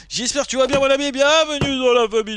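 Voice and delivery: high, effusive greeting; excited welcome. Real, not acted. Streamer welcoming a new follower into the family, high and excited. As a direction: welcome them high and eagerly.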